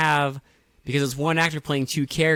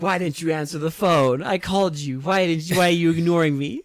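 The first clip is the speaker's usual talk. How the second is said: put-on higher-pitched parody of a mother confronting her boyfriend; whiny mock demand, getting quicker at the end; mock pleading; 5 semitones higher than usual. Acts out mother confronting the dead boyfriend.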